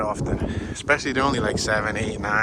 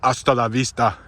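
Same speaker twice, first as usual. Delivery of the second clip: a light hint of a gruff Austrian action hero; lightly voiced Terminator catchphrase; Arnold Schwarzenegger impression; 2 semitones lower than usual. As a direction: toss off the movie catchphrase in a passing mock Terminator voice. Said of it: "my Arnold Schwarzenegger".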